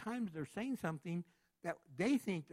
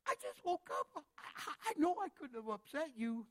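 much higher, startled protest; baffled; 11 semitones higher than usual. Retold or imitated. Preacher voices someone startled by a partner screaming at dawn, high and bewildered. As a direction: sound caught off guard and confused. Puzzled reply to morning anger.

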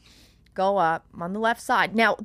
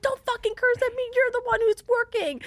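high, quick retold yelling; heated comeback. Traveler replaying her comeback to the lady, heated. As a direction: fire it back fast and high. Acting out screaming match.

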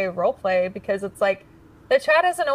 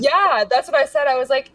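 ordinary talk

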